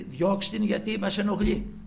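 hammed-up irritated disciples; annoyed demand. Disciples urging the Lord to send her away, annoyed. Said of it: "να λένε ότι".

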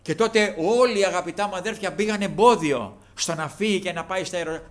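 ordinary talk

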